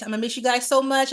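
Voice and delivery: ordinary talk, nothing marked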